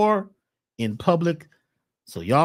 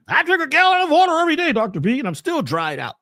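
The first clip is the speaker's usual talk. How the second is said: high, loud complaining client voice; a complaining patient, pitched way up and loud; whiny client; 11 semitones higher, 4 dB louder, faster than usual. Retold or imitated. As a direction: play the parched client moaning to the doctor. Mocking a thirsty client's complaint.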